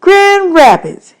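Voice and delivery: high, slow astonished repeat; unbelieving. Acted. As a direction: say it like six exclamation points. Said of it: "like it was the most unbelievable thing".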